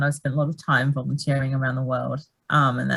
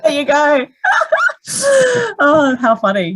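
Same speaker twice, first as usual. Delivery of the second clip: laughing hard, higher and louder; real laughter at the joke; 12 semitones higher, 8 dB louder than usual. Real, not acted. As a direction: crack up through the words. Cracking up at eye joke.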